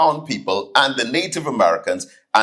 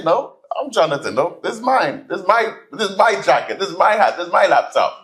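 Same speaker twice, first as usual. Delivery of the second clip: higher, insistent listing; brazen denial; 6 semitones higher, faster than usual. Retold or imitated. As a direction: stubbornly claim everything.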